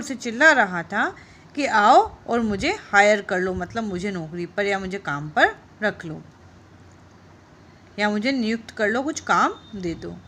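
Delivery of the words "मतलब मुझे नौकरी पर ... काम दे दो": ordinary talk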